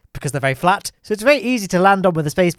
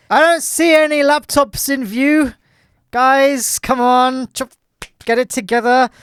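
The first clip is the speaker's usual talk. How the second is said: mock scolding; 7 semitones higher, 3 dB louder, slower than usual. Scolding idle Kerbal crew.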